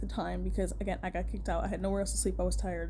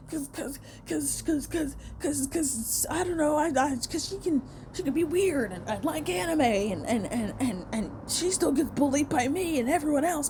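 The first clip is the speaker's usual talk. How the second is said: higher, whiny, stammering mock voice; mocking bully; 4 semitones higher than usual. Bully justifying beating up a kid, mocked in a higher stammering voice. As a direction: imitate a bully fumbling for lame excuses.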